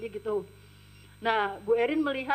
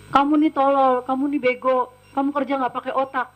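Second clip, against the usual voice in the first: louder, mock furious insults; retold angry scolding; 2 semitones higher, 7 dB louder than usual. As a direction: imitate an angry boss berating the maid. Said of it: "beliau pun marah ke saya".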